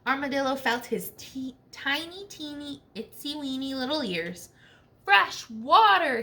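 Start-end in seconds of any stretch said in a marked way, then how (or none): 5.0-6.2 loud, slow joyful shout; excited shout. Acted. Armadillo, hearing of fresh water, shouts out with joy. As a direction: call it out like finding treasure. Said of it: "he shouted".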